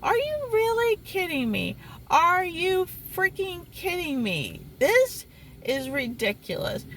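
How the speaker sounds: high-pitched exasperated disbelief; retold outrage. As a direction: reenact being so mad, pitch way up. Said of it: "I was so mad".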